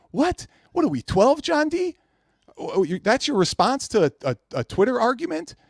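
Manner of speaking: high, disbelieving what; mock outrage at a childish reply; incredulous retort. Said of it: "I'm like".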